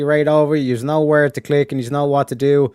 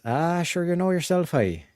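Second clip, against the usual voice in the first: soft, played-up Irish lilt; Irish accent; 7 dB quieter than usual. Shown as a demonstration. Streamer playing up the accent for a viewer, soft Irish accent. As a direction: quietly put on a wee Irish accent. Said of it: "that wee little Irish accent".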